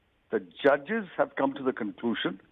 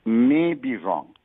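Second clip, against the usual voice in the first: "may" leaned on, louder and drawn out; stress on may; 4 dB louder, slower than usual. Real, not acted. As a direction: put clear weight on "may" before "be wrong". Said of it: "you're stressing and emphasizing on the word may".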